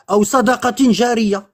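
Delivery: ordinary talk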